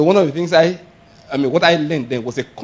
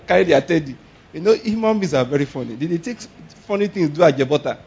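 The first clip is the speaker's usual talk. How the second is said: ordinary delivery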